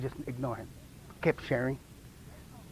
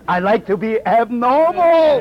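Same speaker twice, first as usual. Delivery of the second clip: louder, slow, drawn-out ABNORMAL; emphatic declaration; 2 semitones higher, 5 dB louder, slower than usual. Shown as a demonstration. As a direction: proclaim "abnormal" proudly, stretching it out loud.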